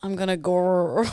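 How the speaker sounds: "gore" ending in a long rolling R; rolled R. Shown as a demonstration. Host gives a southern example, rolled R on "gore". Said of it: "they sort of roll their R's a bit more".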